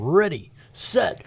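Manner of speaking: high, loud, stretched-out call; hyped announcer flourish. Acted. Host kicking off the radio fundraising campaign, hyped announcer call. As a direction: draw it out like a launch countdown.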